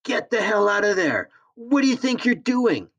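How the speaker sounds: put-on woman shrieking at a boy in her pack; shrieking like a banshee. A narrator's reading. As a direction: shriek it out in furious panic. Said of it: "she shrieked like a banshee".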